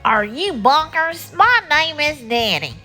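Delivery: high, mildly indignant monster; howling monster. Acted. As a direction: object as an offended monster. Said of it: "looked down with a horrible howl".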